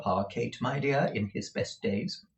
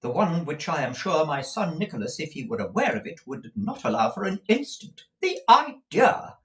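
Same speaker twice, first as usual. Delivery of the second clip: indignant, higher matron; with some warmth; 6 semitones higher than usual. A narrator's reading. Mother indignant about rumours of her grand relations, a touch heated. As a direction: bristle at the insult. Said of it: "with some warmth".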